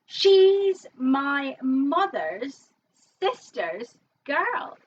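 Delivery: very slow drawn-out caller voice; bingo call. Acted. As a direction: stretch every word like a caller.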